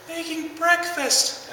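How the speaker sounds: put-on high, slow little-kid voice. Uncle retelling the four-year-old's midnight answer in a high, slow kid voice. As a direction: mimic a sleepy four-year-old, high and drawn out.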